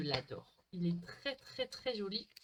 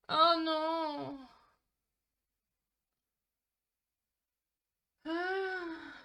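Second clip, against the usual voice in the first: loud, high wail of dismay; dismayed cry; 6 semitones higher, 6 dB louder, much slower than usual. Real, not acted. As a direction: groan as it all crashes. Stream bugs. (cri de dépit)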